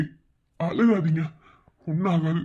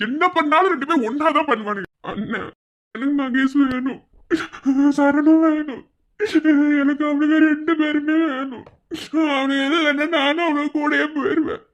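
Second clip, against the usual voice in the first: anguished wail; 7 semitones higher than usual. Acted. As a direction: cry out for both sons. Parent wailing for both sons. (உயர்ந்த குரலில் புலம்பல்)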